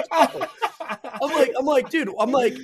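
talking through real laughter, high-pitched; laughing. Real, not acted. Storyteller quoting his reply to an aggressive stranger, laughing. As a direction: struggle to go on through the giggles.

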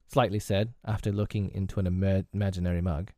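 usual speaking voice

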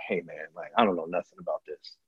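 very soft, low aside as the pastor; imitating sheepish confession. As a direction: murmur it low, as if admitting ignorance. Pastor privately admitting he knows nothing.

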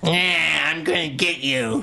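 creaky mock-menacing gargoyle. Shown as a demonstration. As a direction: put on a silly gargoyle threat. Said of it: "what did the voice sound like?".

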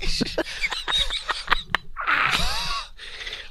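long, high wheezing laugh; helpless laughter. Real, not acted. Host cracking up after the newspaper story, laughing helplessly. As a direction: collapse into soft wheezy laughter.

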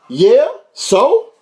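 high, loud, challenging so-what; defiant comeback. Retold or imitated. Answering the stranger in the truck, defiant. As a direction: toss it back like you don't care.

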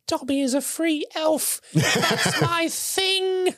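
high, slow elf squeak with a laugh; proud Dobby. Retold or imitated. Dobby defying the Malfoys, slow high squeak. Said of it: "And he's like".

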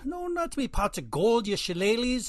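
high Irish leprechaun voice. Acted. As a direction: play a cheeky leprechaun. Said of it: "you're a leprechaun".

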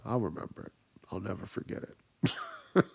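very soft retold vow; hushed, mock-ominous promise; quiet grudge. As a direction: let it sink to a near-whispered grudge. Retells vowing grudge after spoiler.

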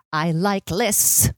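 "I like lists." very slow and deliberate, in character; Joanne from Rent. Acted. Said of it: "I'm Joanne".

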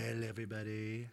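Trump impression. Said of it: "That's my in to Trump is China".

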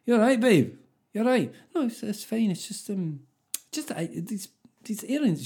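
high-pitched, hesitant put-on voices; imitated awkward couple. Couple over disappointing Christmas earrings, high hesitant mock voices.

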